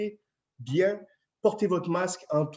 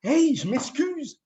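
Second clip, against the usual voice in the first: excuse amusée; 9 semitones higher, 4 dB louder, slower than usual. Real, not acted. Chef s'excuse en riant.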